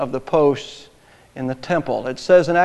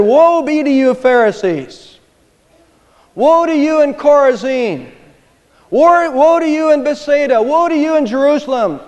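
higher, louder woe cries; thundering woe; 8 semitones higher, 6 dB louder than usual. Retold or imitated. Jesus denouncing the Pharisees, loud thundering woes. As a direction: denounce them, each woe hammered. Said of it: "Jesus said".

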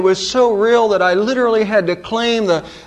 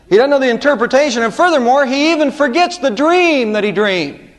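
fast, loud, rising pulpit push; preaching; 7 semitones higher, 3 dB louder than usual. Acted. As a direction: drive it home to the congregation. Building emphasis in sermon.

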